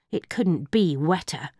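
usual speaking voice

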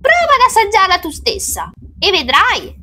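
put-on high-pitched clever-kid voice; sly child. A narrator's reading. Child tricking the witch into tasting, high sly dare. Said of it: "continuò argutamente Pietro".

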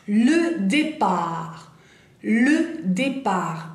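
"Le départ" said very slowly, with no final T sounded; drawn-out teacher model of "départ", with a silent T; slow, clear French "le départ" for students to repeat. Shown as a demonstration. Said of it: "T final no la pronunciamos".

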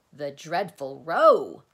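loud, eager, punched answer; bright volunteering. A narrator's reading. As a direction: offer it brightly and loudly. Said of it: "volunteered the humbug brightly".

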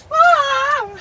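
loud, high startled "Aa!"; sudden full-voiced yelp; startled scream. Real, not acted. Startled by the door, loud high scream.

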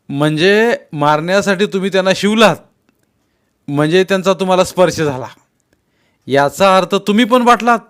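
forceful, challenging rebuke; stern confrontation. A narrator's reading. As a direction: drive each point home loudly. Elder confronts boys with their logic.

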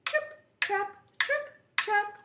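high, short rhythmic hoof beats; hoof sounds. Acted. Storyteller making little goat's hoofs go, high. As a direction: chant each step high and crisp. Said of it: "and he went".

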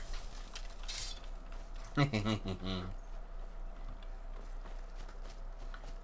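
silent breathy wheeze of laughter; whispered giggle. Real, not acted. Laughing after dying.

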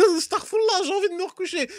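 high whiny mock voice; lightly whined inner voice; mock sleepy body. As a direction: complain like a sulky child. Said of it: "tu me dis".

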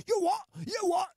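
very high-pitched, incredulous comeback; mock indignation. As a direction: act taken aback, voice shooting up high. Acting someone misunderstanding the sarcasm.

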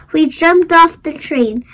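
ordinary delivery

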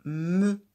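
"Me." said loud and distinct; teacher giving the answer "Me." loudly; answer syllable. Shown as a demonstration. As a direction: give the answer "Me." clearly. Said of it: "Quelle est cette syllabe?".

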